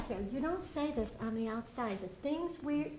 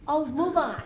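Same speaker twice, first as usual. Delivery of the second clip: louder, imitating people who say move on; mock dismissive quote; 8 dB louder than usual. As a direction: brush the griever off, mimicking. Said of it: "they wanna shut you up".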